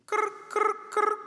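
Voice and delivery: high, loud imitated cricket chirping; playful cricket noise at a silent room; cricket chirps. Mocking silent congregation.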